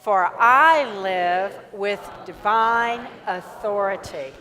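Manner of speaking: slow, loud chanted declaration; group affirmation. Acted. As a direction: proclaim it slowly and loudly with the congregation. Congregation affirmation led slowly.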